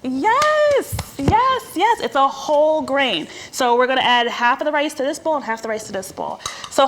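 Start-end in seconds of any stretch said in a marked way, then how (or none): 0.0-2.0 high, drawn-out, delighted yes; delighted approval. Real, not acted. Chef praising helper's whole-grain answer, delighted. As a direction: celebrate the answer in a much higher voice.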